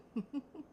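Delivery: short light chuckle; amused laugh. Real, not acted. Painter amused by her peepers left on her apron, chuckling. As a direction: chuckle at your own slip. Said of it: "I'm laughing because".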